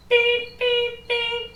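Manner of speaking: high, loud, even electronic beeps; three piercing monotone beeps; imitated beeper. Game master showing the button being hit, high beeps.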